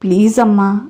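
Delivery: usual speaking voice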